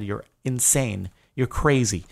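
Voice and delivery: ordinary talk, nothing marked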